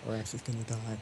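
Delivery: muttered under the breath; mumbled. Real, not acted. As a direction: say it half to yourself. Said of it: "What was that?".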